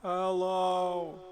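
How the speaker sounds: long high, loud held hello; calling out. Pretend. Gamer testing the reverb in the space, long loud call. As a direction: call out loudly to hear the echo. Calling out to test room echo.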